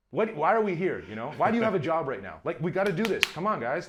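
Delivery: loud, high exasperated rant; outraged. Retold or imitated. Director berating crew dragging their feet, outraged. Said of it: "completely outraged".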